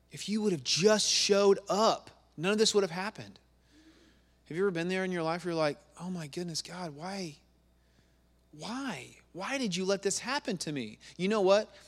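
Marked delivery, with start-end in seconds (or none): 0.1-3.4 higher, louder accusing plea; angry reproach. Acted. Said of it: "Mary is so angry with Jesus". 6.0-11.0 soft acted plea to God, rising in pitch; anguished questioning. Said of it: "It's okay to be angry at God".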